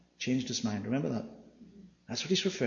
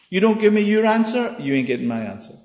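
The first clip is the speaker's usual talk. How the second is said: higher, louder tit-for-tat jab; cheeky comeback, raised; defiant retort; 7 semitones higher, 6 dB louder than usual. Acted. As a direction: throw it back at them. Preacher paraphrasing Jesus' retort.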